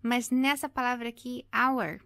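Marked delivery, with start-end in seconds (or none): 1.5-2.0 "hour" begun straight on the vowel, no H; silent H. Shown as a demonstration. Teacher contrasts with usual English H, silent H in "hour". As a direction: drop the H completely in "hour". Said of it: "o H não tem som".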